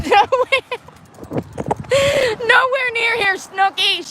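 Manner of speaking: high mock voice, laughing into it; silly put-on voice. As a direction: play along in a cartoon voice. Answering in a put-on voice.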